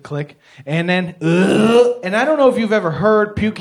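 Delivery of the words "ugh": loud mock retch; mock vomiting. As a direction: heave it out in one burst. Imitates Mitchell puking into bag.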